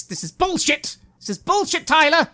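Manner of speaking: high, loud exasperated yell; fed-up woman shouting at Tyler; angry outburst. Acted. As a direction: throw it at Tyler in frustration. NPC snaps at player.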